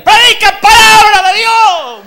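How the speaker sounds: high, very loud, slow shout. Retold or imitated. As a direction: bellow it from the pews. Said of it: "me dijo un gitano".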